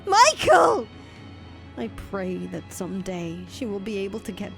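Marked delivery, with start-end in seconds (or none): 0.1-0.9 high, loud cry of his name; screaming his name; anguished scream. Acted. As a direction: shriek his name in despair.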